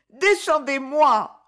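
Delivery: high, loud, slow whining plea; whining cry. A narrator's reading. Wife stuck on the pedestal, whining to be let down. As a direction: wail to be let down. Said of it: "geignait-elle".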